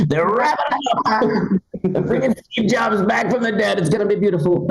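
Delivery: high, put-on political bragging; boastful politician impression. Comic imagines phone policy, boastful politician impression. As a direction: promise big things in a high-pitched put-on voice.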